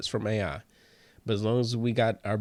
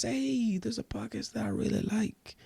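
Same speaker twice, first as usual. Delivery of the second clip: put-on a higher, softer Mexican vato accent; 10 semitones higher, 5 dB quieter than usual. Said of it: "I turned, like, into a vato, a Mexican man".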